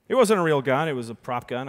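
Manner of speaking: ordinary talk, nothing marked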